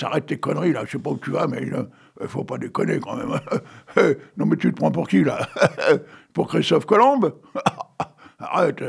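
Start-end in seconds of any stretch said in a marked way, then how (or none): 4.0-8.9 laughing. Real, not acted. Laughing at Maurice's poem.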